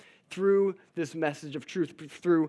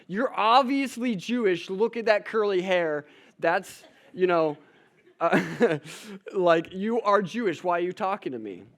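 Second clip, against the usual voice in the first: higher and louder, indignant disbelief; incredulous Samaritan woman; 5 semitones higher, 6 dB louder than usual. Acted. Samaritan woman questioning Jesus at the well, higher, louder and incredulous. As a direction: play her as thrown off and defensive.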